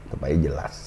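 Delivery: soft, low voice that still carries; quiet but clear. Shown as a demonstration. Coach demonstrating soft clear speech. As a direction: speak softly with chest resonance. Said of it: "nih ya, gua pelan ya".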